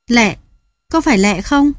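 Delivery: high, urgent call after her; a startled man calling into the dark. Acted. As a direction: call her name, desperate to know. Old man calling after fleeing woman.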